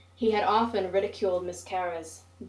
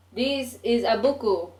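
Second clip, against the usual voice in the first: higher, louder mock Japanese-English accent; 4 semitones higher, 6 dB louder than usual. Narrator goading Oi in a higher mock Japanese English accent. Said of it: "mimicking the first English learned".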